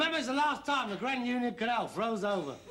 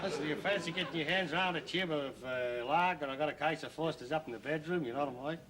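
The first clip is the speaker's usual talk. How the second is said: lower, quick put-on Aussie; Australian accent; 5 semitones lower, 3 dB quieter, faster than usual. Son playing tourist offering lager, mock Australian accent. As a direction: chat someone up in broad mock Australian. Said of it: "you can do an Australian accent".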